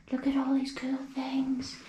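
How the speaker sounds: hushed, amazed whisper. Real, not acted. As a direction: breathe it out in wonder. Hushed awe in the room.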